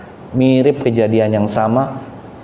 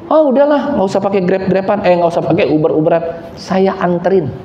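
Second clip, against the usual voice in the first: high, eager offer of a ride; 7 semitones higher than usual. Retold or imitated. Young woman eagerly offers the pastor a ride, high and bright. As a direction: brush off the app and insist on driving him.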